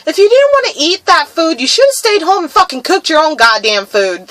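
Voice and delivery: retold angry rant. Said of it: "I was just like".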